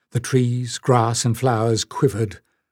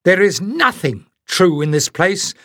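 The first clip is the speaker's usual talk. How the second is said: defiant outburst; 5 semitones higher than usual. A narrator's reading. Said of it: "Jasmine burst out".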